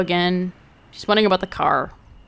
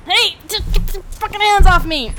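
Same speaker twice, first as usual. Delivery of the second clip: higher, louder, yell to break free; angry shout; 9 semitones higher, 4 dB louder than usual. Acted. Young man shoving off the cop, shouting angrily. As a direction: shout him off you.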